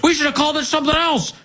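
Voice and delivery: mocking high-pitched, hurried outburst; high, rushed mock-politician voice; Biden. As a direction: imitate a politician blurting it out, high and fast. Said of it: "he goes".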